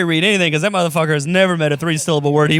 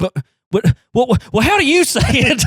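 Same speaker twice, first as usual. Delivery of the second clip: mock flustered dad; 9 semitones higher, 4 dB louder than usual. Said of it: "He's like".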